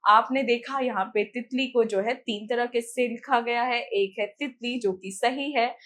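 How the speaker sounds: ordinary delivery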